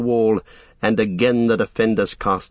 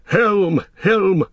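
shouted war cry of riders; riders' shout; 9 semitones higher than usual. A narrator's reading. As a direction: rally cry ringing out. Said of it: "the riders shouted".

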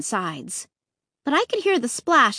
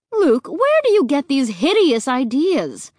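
higher, louder motherly protest; demanding; 4 semitones higher, 5 dB louder than usual. A narrator's reading. Mom reacts to Luke's hideous ideas, demanding and appalled. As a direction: scold him with mild exasperation, pitched up. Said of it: "Mom demanded".

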